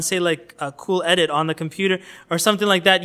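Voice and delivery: ordinary delivery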